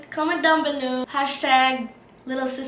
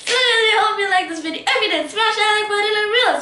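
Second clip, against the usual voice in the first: put-on high bubbly kid-star outro; bright, peppy outro, a touch higher; [name] voice; 6 semitones higher, 4 dB louder than usual. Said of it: "you sound like [name]".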